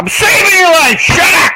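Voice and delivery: very high, loud comic shout; retold exasperated shout. Rescuer to protesting tortoise, exasperated mock shout. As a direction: scream back at the animal you are rescuing.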